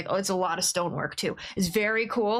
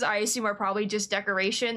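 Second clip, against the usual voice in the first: normal talking voice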